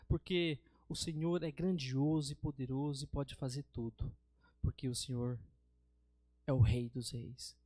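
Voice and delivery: low, slow, measured praying voice; prayer of adoration. Shown as a demonstration. Preacher demonstrates worship before God, reverent prayer. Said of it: "eu vou fazer aqui uma oração de adoração a Deus".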